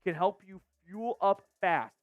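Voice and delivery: smooth, even ad-copy read; sponsor ad read. Acted. Host reading the Factor Meals sponsor spot, ad read. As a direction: sell the meal service clearly.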